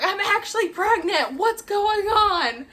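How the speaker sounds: high, slowed, frantic wail; panicked high disbelief. Retold or imitated. Telling best friend she's pregnant, high frantic disbelief. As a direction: pitch up into stunned panic.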